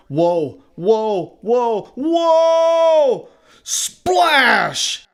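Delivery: rising whoas to a long high yell, then a big splash; teeter, then topple with a shout; escalating cries of falling overboard; alarmed whoas. A narrator's reading. Fishermen lose balance and fall in.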